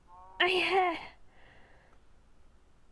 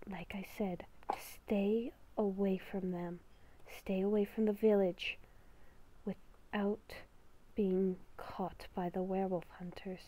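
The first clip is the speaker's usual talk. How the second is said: beast within voice; 5 semitones lower, 10 dB quieter than usual. Acted. Inner beast whispering a warning to Haley, hushed and ominous. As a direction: whisper like a creature living inside her head.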